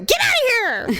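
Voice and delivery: high, hammed-up banishing cry; mock furious dismissal. Shopper banishing the rude woman, mock fury.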